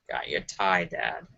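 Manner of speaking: very low, soft villain rumble; very low rumbling villain voice; Vader voice. Pretend. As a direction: drop the voice deep into a menacing growl. Vader voice in joke.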